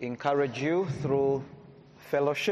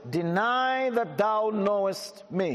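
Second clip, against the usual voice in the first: raised, slowed King James reading; scripture reading; 5 semitones higher than usual. Acted. Preacher reading Luke aloud to the congregation, raised and proclaiming. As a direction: proclaim the verse to the hall.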